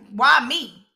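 imitating a bewildered "why me?"; questioning. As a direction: act out puzzled self-doubt. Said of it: "it's almost like he's questioning".

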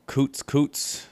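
coots said high, coots twice, careful Hebrew sound; Hebrew pronunciation. Shown as a demonstration. Teaching listeners the Hebrew word, careful coots. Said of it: "That's how you pronounce it".

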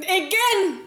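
"again" pitched high and punched; emphatic "again". Shown as a demonstration. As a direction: hit "again" hard, lifting the voice. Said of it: "die Betonung liegt auf".

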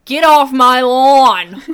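put-on loud grumpy old-timer shout; old prospector. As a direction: yell like a cranky old man. Grumpy old man shout joke.

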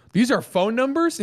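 confused Biden. Retold or imitated. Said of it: "And he goes".

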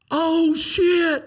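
jolted, seeing it come back; shocked oh shit. Retold or imitated. Telling a friend the light returned, high startled cry. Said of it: "And I'm like".

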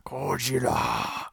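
slow unvoiced whisper. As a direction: breathe the name out slowly. Whispered monster name.

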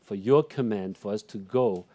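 earnest spoken prayer, rising in fervour; closing prayer. Acted. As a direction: pray over the congregation.

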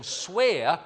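loud, high, commanding proconsul; urging. A narrator's reading. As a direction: press the demand forcefully, word by word. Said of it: "the proconsul then urged him".

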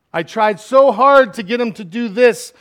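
loud, high frustrated outburst. Acted. God venting over wayward people, frustrated. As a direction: lean hard on the effort, exasperated. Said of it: "you hear that frustration in his voice".

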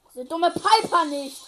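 loud, drawn-out angry yell; frustrated shout. Real, not acted. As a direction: yell it out, can't land a hit. Missing enemy in tense fight.

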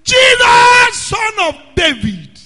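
high ringing yell; loudest shout. Retold or imitated. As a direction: shout as loud as you can. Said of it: "he got the loudest shout".

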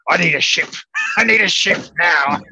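hammed-up pirate barking for a ship; pirate demand. Teammate in pirate costume demanding a ship from travel agent, mock pirate voice.

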